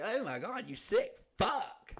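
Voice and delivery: high-pitched mock horror; appalled normal person. Normal people reacting to the chipmunk killer, appalled. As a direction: recoil in drawn-out, high-voiced disgust. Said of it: "you say that to normal people".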